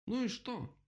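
very high mock yell. A narrator's reading. Rabbit defies the bear, mock yelling. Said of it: "заорал кролик".

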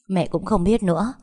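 plain reading voice

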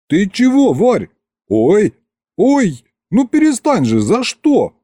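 high squeals of mock protest; protesting ouch. Husband squealing as his wife keeps poking him, high mock protest. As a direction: beg her to stop between yelps.

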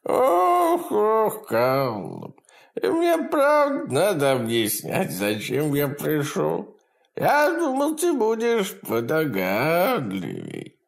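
high, gleeful imp voice; cackling hell torturer. Acted. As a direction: taunt him with cruel glee. Demon taunts victim.

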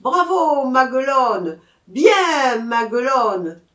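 loud, high crowd bravos; acclaiming cheer. A narrator's reading. Crowd acclaims the proud girl, loud high cheering. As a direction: cheer her on at full voice. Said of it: "applaudit à tout rompre et l'acclama".

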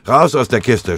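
loud, high barked order; shouting. Acted. Tinnes orders the men out of the truck, shouting. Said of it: "schrie er".

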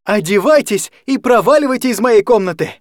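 high, loud furious command; angry order. Acted. Anya ordering the guardian's woman out of her room, angry.

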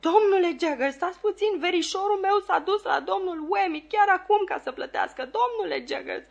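very high, frantic begging; hysterical pleading. A narrator's reading. Client chasing the lawyer and begging him, hysterical. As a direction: beg desperately in a strained high voice. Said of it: "răgni individul isterizat".

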